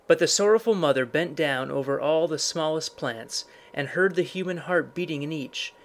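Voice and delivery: plain reading voice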